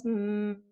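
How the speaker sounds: "mm" attempted with the nose pinched; M sound with nose plugged. Shown as a demonstration. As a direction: hum "mm" briefly against a plugged nose. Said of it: "If you plug your nose".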